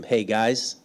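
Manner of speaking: Joshua reminding. Retold or imitated. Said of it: "like Joshua was reminding them".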